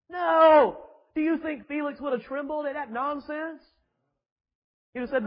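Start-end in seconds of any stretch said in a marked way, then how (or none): 0.1-0.8 sharp, loud, higher no. Real, not acted. Preacher rejecting the flattery with a loud no. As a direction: bark the answer out. Preacher answers his own question.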